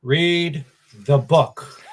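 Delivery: slow, weighty pronouncement; emphatic, measured command; Christopher Lee quote. Retold or imitated. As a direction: deliver each word firmly and separately. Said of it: "I will repeat what Christopher Lee once said".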